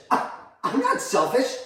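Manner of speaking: high, slow, stunned denial; affronted disbelief. Acted. Mother accused of being selfish, affronted disbelief. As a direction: deny it slowly, taken aback.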